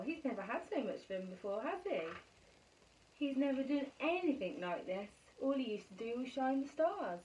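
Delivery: plain reading voice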